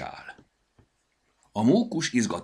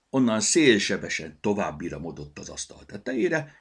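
ordinary delivery

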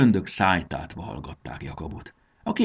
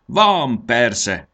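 quick eager comeback, pitched up; prompt retort; 5 semitones higher, 6 dB louder than usual. A narrator's reading. Benő answers Módusz's request for lodging at once, prompt retort. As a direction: answer straight back, higher and louder at first. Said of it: "vágta rá Benő".

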